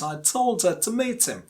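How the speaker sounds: weight on TOLD and MEET, the small words reduced; stress on TOLD and MEET. Shown as a demonstration. Teacher recapping for learners, TOLD and MEET stressed. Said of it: "the second one is meet".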